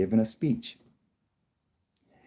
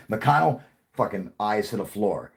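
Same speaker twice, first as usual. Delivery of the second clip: usual speaking voice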